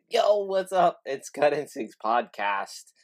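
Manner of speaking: loud, high hype intro; hyped intro shout. Acted. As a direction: shout the show open.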